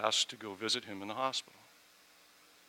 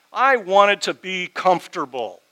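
slow, louder self-rebuke; loud confession; 8 semitones higher, 14 dB louder than usual. Real, not acted. Pastor admitting he wanted comfort, slow and loud. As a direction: drive home the selfish admission.

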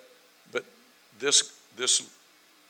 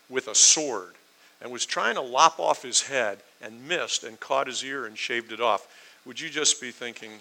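usual speaking voice

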